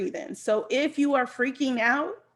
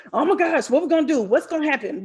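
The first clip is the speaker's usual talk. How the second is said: hammed-up panic, jumping higher and louder; mock freak-out; 4 semitones higher, 4 dB louder, faster than usual. Coach mimicking someone who responds badly when things go crazy, mock panic. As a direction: act out an overreaction to things going crazy. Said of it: "Instead of freaking out".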